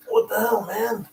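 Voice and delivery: high, exasperated mock complaint; annoyed people. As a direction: imitate a frustrated believer. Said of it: "say to themselves".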